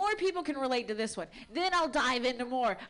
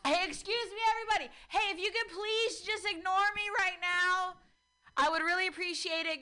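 higher mock announcer calling for attention; mock announcement; 5 semitones higher than usual. Comic pretending to grab the room's attention, mock announcer. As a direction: play a pushy announcer at the mic. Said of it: "I'm just talking really loud".

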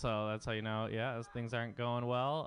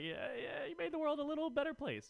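high-pitched admiring bro. Retold or imitated. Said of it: "I was like".

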